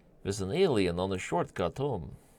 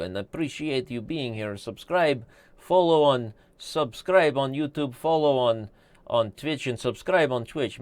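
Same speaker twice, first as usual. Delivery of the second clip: mock broken-English accent; 2 semitones higher than usual. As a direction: sign off like a hammy foreign chess host. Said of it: "am I talking like an Orthodox?".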